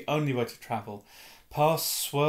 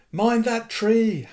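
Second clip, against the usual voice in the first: slow, much higher and louder bellow; 11 semitones higher, 8 dB louder, much slower than usual. Acted. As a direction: drag out the warning at full force. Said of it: "Harry bellowed".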